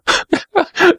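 high-pitched gleeful chuckle; mocking chuckle. A narrator's reading. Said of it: "chuckling louder than ever".

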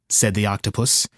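usual speaking voice

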